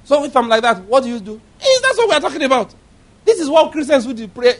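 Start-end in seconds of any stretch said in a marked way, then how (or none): none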